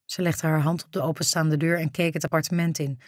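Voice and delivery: plain reading voice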